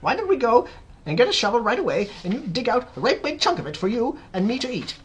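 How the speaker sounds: high, loud eager urging; Aunt Sponge crying out. A narrator's reading. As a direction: gush excitedly about eating. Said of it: "she cried".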